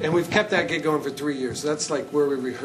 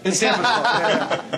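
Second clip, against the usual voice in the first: laughing out the answer, louder and higher; 6 semitones higher, 6 dB louder, slower than usual. Real, not acted. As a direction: let a real laugh spill into the agreement. Joking answer to host's tease.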